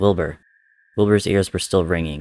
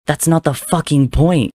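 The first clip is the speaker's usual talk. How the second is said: much higher, louder cry through tears; sobbing outburst; 9 semitones higher, 6 dB louder than usual. Acted. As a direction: break into a raging sob. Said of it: "he sobbed".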